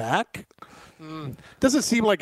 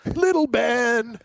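slow and higher, savoring each word; mocking drawl; 7 semitones higher, much slower than usual. Co-host repeating the punchline, slow mocking. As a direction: stretch it out teasingly. Savoring the joke about Little Ben.

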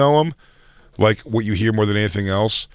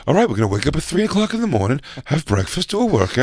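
higher put-on workout planner; mock fitness fanatic; 4 semitones higher than usual. Comic plays actor planning early morning workouts, mock gung-ho. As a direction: rattle off a regimen as a hammed-up star. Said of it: "he goes".

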